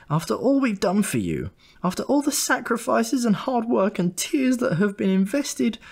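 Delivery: high, ranting mother's voice; shrill scolding mother; mother's tirade. A narrator's reading. Mother scolding her son over his misdeed, high and ranting. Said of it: "I heard things like this".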